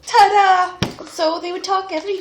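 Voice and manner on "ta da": high, loud ta-da; triumphant reveal. Acted. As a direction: announce it with a flourish. Prince's arrival announced.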